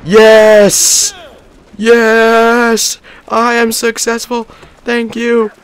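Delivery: very high, loud victory yells; ecstatic cheer. Real, not acted. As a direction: celebrate like you won everything. Victory celebration.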